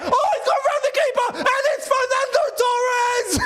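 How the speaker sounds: high, giddy laughing shout; laughing with joy. Real, not acted. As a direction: laugh out the goal in disbelief. Player rounds keeper, about to score.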